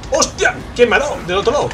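high, loud shocked outburst. Real, not acted. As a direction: yelp in shock. Shot suddenly in game.

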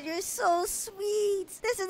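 high, soft and slow; soft swooning; touched gush. Acted. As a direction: melt at the proposal. Moved by proposal.

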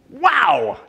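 high, excited wow. Real, not acted. Pastor imagining [name] asking to come over, excited. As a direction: exclaim it high, thrilled at the idea. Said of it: "I'm getting excited thinking about it".

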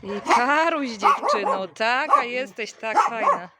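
loud excited pet praise; gushing praise. Real, not acted. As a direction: gush over the dog loudly.

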